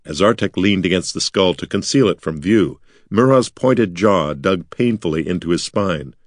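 ordinary delivery

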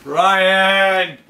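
calling out. Acted. Calling out to Ryan.

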